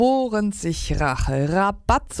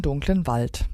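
plain reading voice

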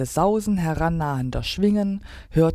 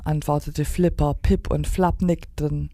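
plain reading voice

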